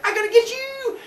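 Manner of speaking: very high, gleeful taunt; playful battle cry. Acted. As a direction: chase your brother in play. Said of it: "he's like".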